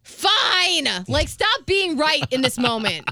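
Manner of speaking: loud, high-pitched giving-in; hammed-up petulant surrender; retold exasperated yell. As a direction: concede grudgingly at a mock yell. Exasperated concession to mom, retold.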